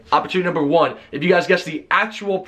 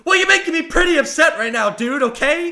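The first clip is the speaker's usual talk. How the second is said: annoyed; 12 semitones higher than usual. Retold or imitated. Retelling argument with closet guy.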